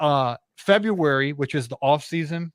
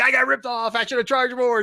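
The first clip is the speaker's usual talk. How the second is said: high, louder strained complaint; mock venting rant; 11 semitones higher, 4 dB louder than usual. Guest acting out a 2 A M parking lot video, mock rant. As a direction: imitate someone griping about being cheated. Acts out YouTuber venting at night.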